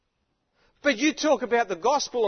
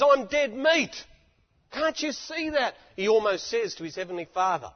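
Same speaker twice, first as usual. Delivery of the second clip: ordinary talk, nothing marked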